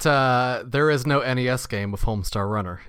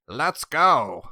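Bob voice. Acted. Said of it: "'cause that's how he sounds".

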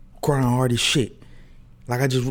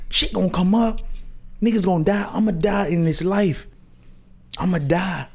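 preaching; 7 semitones higher than usual. Acted. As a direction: preach it to the congregation like a prophet. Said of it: "The good prophet is preaching to the community".

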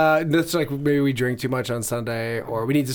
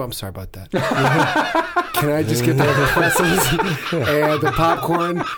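laughing. Retold or imitated. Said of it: "I go".

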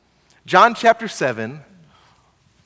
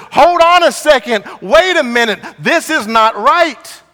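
louder, higher objection as Nicodemus; speaking up in protest; 6 semitones higher, 6 dB louder than usual. Retold or imitated. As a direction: halt them firmly, voice raised. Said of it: "Nicodemus speaks up".

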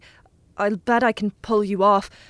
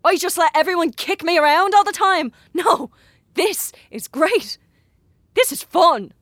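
louder and higher, wild triumphant rant; manic glee; 8 semitones higher, 5 dB louder than usual. Acted. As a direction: revel in the power, unhinged. Boy exults over his power.

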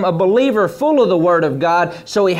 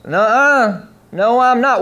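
mock protest, pitched up; Peter's indignant denial; 4 semitones higher, 4 dB louder, slower than usual. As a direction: jump in high with an acted refusal. Peter acted contradicting Jesus.

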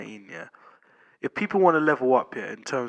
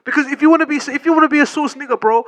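excited; 6 semitones higher, 5 dB louder than usual. Real, not acted. Host heats up arguing the point.

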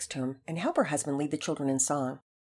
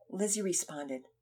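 ordinary delivery

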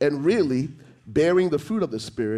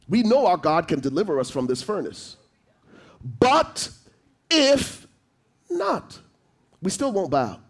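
impassioned quote; 6 semitones higher than usual. Acted. Quoting the Hebrew boys' prayer.

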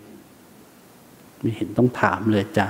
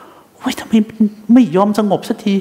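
exasperated groan; 8 semitones higher than usual. Retold or imitated. Meditator complaining that the mind won't calm, exasperated. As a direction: whine at your own mind that won't settle.

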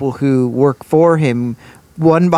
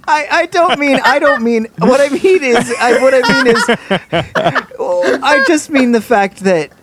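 higher, flustered and laughing; 10 semitones higher than usual. Real, not acted. Explaining himself to laughing friends, flustered. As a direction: backpedal while laughing. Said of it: "flustered backtracking".

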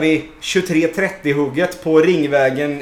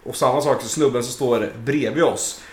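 usual speaking voice

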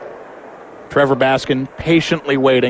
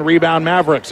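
ordinary talk

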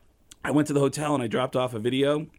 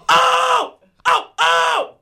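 Kinison scream; 7 dB louder, much slower than usual. Retold or imitated.

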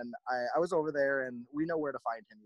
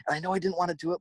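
usual speaking voice

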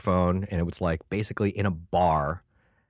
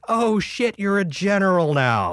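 much higher and louder, startled; mock shock; 12 semitones higher, 4 dB louder, slower than usual. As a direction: act stunned by the news. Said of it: "I'm like".

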